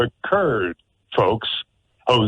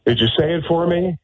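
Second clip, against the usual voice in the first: ordinary talk, nothing marked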